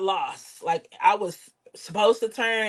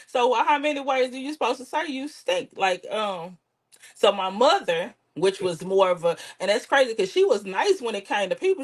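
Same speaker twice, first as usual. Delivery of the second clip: ordinary delivery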